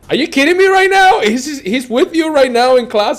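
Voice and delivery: very high, loud mock shriek of disbelief; imitating a friend losing it over the news; shocked. Friend Wes hearing the producer is in class, shocked shout. Wes's retold shocked reaction.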